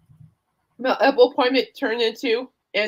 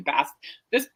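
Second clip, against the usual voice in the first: usual speaking voice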